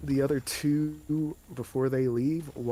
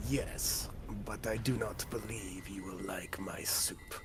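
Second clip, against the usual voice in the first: whispery hobgoblin; 5 semitones lower, 9 dB quieter than usual. Acted. Hobgoblin warning the party about goblins, low whisper. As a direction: hush it like a sly creature.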